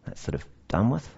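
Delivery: a mildly mocking complacent churchgoer; smug dismissal. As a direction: toss it off glibly. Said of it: "more worrying responses like".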